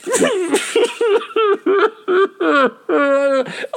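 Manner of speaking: high-pitched wailing sob; imitated bawling. Hiker retelling how he broke down, imitated bawling. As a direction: wail it out, voice breaking into sobs. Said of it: "I just started bawling".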